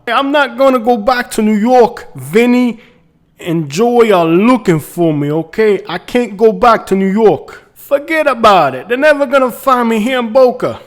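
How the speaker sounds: put-on high, loud New York accent; New Yorker accent. Realtor hiding from Vinny in Boca, New York accent. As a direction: belt it out like a hammed-up New Yorker. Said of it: "picked up a New Yorker accent".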